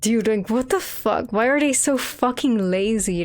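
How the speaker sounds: ordinary talk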